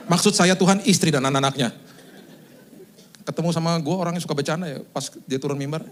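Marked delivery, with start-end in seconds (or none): 0.1-1.9 louder, emphatic mock self-correction; hasty correction. Praying pastor correcting his slip to God, loud mock correction. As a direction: raise the voice to fix the slip.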